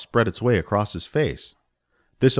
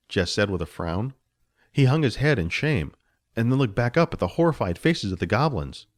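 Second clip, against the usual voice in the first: plain reading voice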